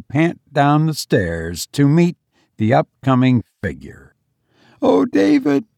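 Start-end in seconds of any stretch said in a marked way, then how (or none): 4.8-5.6 high, overcome mother voice; sobbing. A narrator's reading. Mother meeting her living son, mildly sobbing. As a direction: exclaim high with a touch of sobbing. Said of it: "she sobbed".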